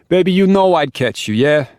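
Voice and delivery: high, loud, playful New York Italian to his wife; teasing New York Italian. Acted. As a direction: tease her back, New York Italian. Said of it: "And then he chuckles".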